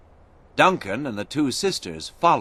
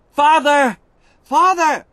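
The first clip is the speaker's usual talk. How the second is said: much higher, loud calling out; desperate shout; 9 dB louder than usual. A narrator's reading. Alice calling out to her father at the fort, shouting. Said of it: "Alice shouted".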